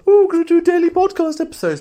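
high, loud, sing-song mockery; mocking doubter. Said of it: "may have suspected that the whole".

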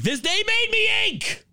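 very high furious shout; angry yell. Real, not acted. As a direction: scream it at the can. Yells at the can.

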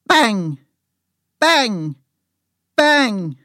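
sharp loud burst, falling in pitch; loud gunshot bangs. A narrator's reading. Narrator voices shots ringing out, loud and sudden. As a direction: punch each word out like a shot.

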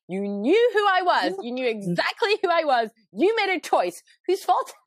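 high, emphatic mock outrage; hammed-up protest, pitch leaping on key words; mock indignant. Retelling her comeback to partner George, mock indignant.

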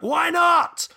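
hammed-up barber yelling; indignant shout. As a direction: bark it back in disbelief. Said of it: "And he's like".

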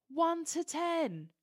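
high, drawn-out announcer call; calling out tickets. Retold or imitated. Steward calling autograph ticket numbers, imitated high, slow call-out. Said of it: "they start calling out autograph tickets".

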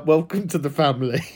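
ordinary talk

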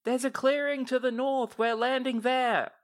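high, loud call from the ship; yelled from a distance. Acted. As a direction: shout it down from the deck. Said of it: "you see her yell out".

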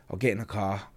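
normal talking voice

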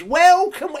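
high, grand showman welcome; theatrical high welcome to visitors; Willy Wonka host. Retold or imitated. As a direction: sweep them in like Willy Wonka. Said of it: "He thinks he's like Charlie, Willy Wonka".

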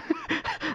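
high mock sobs that are laughter; pretend crying. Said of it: "pretend to cry".

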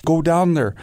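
mock-panicked rider blurting it out; freaked-out apology. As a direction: rider apologizing after nearly trampling him. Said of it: "he's almost freaked out".

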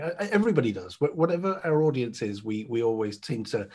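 ordinary talk, nothing marked

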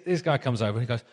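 ordinary talk

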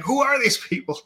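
high-pitched mock bewilderment; baffled outburst. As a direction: exclaim it high, utterly lost. Retold baffled reaction to show.

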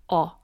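the Korean vowel "o" said alone, carefully. Shown as a demonstration. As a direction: isolate the Korean vowel "o" as a demo. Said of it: "the one Korean vowel I struggle with".

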